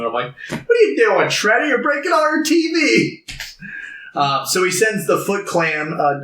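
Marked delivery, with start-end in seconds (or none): 0.5-3.2 high, climbing put-on complaint; mock exasperation. Viewer scolding Shredder for breaking the TV, mock exasperation. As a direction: whine at him in mock outrage.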